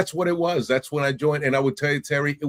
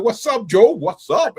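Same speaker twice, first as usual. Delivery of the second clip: loud, high-pitched mock-buddy holler; retold exuberant hello; chummy greeting; 8 semitones higher, 6 dB louder than usual. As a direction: hail a drinking pal with gusto. Acted greeting to new best friend.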